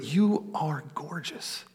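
slow, breathed-out whisper; tender whisper. Shown as a demonstration. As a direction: whisper an admiring compliment, drawn out slowly. Tender compliment to a loved one.

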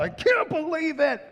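high, hammed-up disbelief; a lightly voiced furious God; mock outraged God. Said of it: "flipping tables over".

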